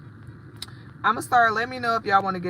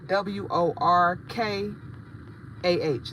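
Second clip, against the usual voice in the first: letters spelled one by one, slowly; slow careful spelling; slower than usual. Shown as a demonstration. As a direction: dictate each letter clearly. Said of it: "spelling Instagram name".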